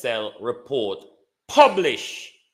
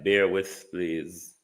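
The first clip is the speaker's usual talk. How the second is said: ordinary delivery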